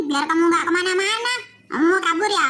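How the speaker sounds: ordinary talk, nothing marked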